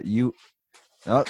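ordinary talk, nothing marked

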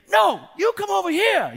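put-on high, loud kid shout; imitated child yelling back; defiant little boy. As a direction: refuse like a tantruming four-year-old. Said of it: "he just wasn't having it".

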